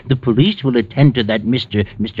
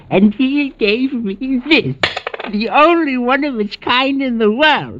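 excited collector; 12 semitones higher than usual. Acted. Collector gloating over rare coin.

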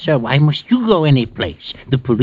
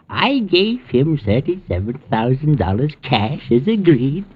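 usual speaking voice

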